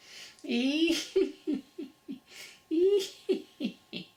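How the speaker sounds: loud, high enthusiastic laugh; enthusiastic laughter. Shown as a demonstration.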